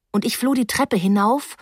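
usual speaking voice

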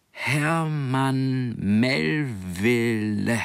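slow syllable-by-syllable reading; sounding out words. Acted. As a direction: spell out each syllable slowly. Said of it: "las Hermann Silbe für Silbe vor".

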